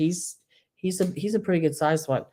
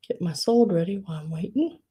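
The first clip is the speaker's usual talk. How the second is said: ordinary talk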